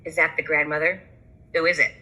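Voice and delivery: usual speaking voice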